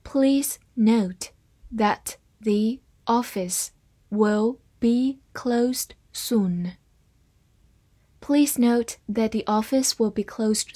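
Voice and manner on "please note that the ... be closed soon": very slow, phrase by phrase, fading to a whisper; slowed-down model sentence; slow reading. Shown as a demonstration. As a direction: read it slowly, chunk by chunk. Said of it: "我们慢读一遍".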